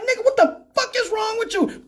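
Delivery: high-pitched acted disbelief; incredulous scolding. Friends looking at the passenger, high and incredulous. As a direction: demand an explanation, appalled.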